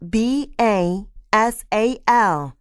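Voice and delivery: slow letter-by-letter spelling; spelled out. Shown as a demonstration. Lecturer spelling a technical name for listeners, spelled out slowly. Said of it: "I'll spell them for you".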